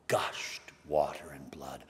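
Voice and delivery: unvoiced, awed whisper; hushed whisper. Real, not acted. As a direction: let the words drop to a breath. Hushed moment of the spear.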